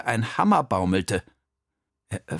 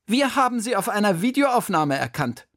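high, louder headmistress voice, calling to account; stern headmistress; 10 semitones higher, 4 dB louder than usual. A narrator's reading. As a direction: question him like a strict headmistress. Direktorin konfrontiert den Hausmeister. (strenge, hohe Stimme einer Direktorin)

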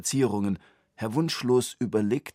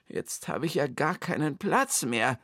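a higher, whiny complaint; whining; 7 semitones higher than usual. A narrator's reading. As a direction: moan about the cramped flat. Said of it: "jammerte Herr Wunschlos". (jammernd, etwas höher)

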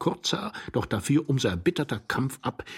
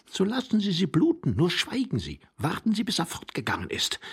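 lightly voiced higher, urgent man; impatient young man; 9 semitones higher than usual. A narrator's reading. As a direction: hush the complainer, pitched up. Young man hushes companion.

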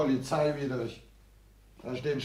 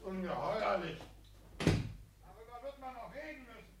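hushed outraged indignation; 8 semitones higher, 10 dB quieter than usual. Acted. Tenant's outraged exit lines.

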